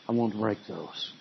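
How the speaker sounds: soft, low, hushed plea to God; quiet prayer. Shown as a demonstration. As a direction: pray it softly, almost under the breath.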